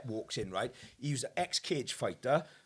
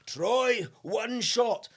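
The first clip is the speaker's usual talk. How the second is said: higher, raised-voice dare; mock challenge; 7 semitones higher, 4 dB louder, much slower than usual. Enforcer on his knees to Troy, mock goading dare. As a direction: imitate a big man goading his friend.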